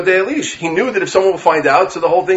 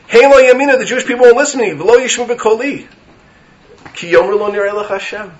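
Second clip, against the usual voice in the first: pleading Moshe; 5 semitones higher, 4 dB louder than usual. Acted. Moshe voiced protesting to Hashem.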